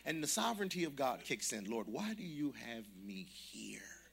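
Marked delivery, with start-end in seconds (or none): 1.6-4.0 slow, unvoiced whisper to God; hushed slow plea; whispered prayer. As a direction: pray it under the breath, slowly. Silent prayer in the restaurant.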